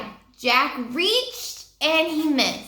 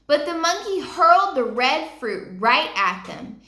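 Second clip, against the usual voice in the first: usual speaking voice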